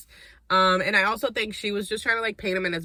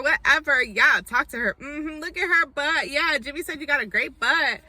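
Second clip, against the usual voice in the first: high mocking girly voice; mock girly chatter, pitched up; mocking party girls; 7 semitones higher than usual. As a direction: ham up the drunk party girls.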